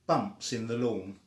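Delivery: ordinary delivery, nothing marked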